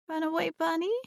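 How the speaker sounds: put-on high small child's voice; tiny voice. A narrator's reading. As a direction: ask shyly like a little girl. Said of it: "she asked in a tiny voice".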